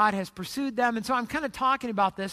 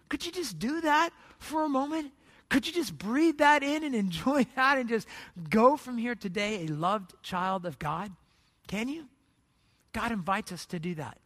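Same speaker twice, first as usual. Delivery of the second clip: hushed invitation, sinking toward prayer; gentle closing appeal; 4 dB quieter than usual. Real, not acted. Preacher leading congregation toward prayer, gentle appeal. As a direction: soften steadily and let the pitch fall away.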